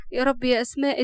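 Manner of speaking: usual speaking voice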